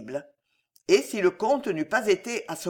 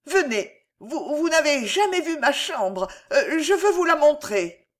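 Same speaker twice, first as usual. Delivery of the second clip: high, halting invitation; desperate stammering; 8 semitones higher, 4 dB louder than usual. A narrator's reading. Alice tries to lure the count away, desperate stammering. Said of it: "elle bégaya".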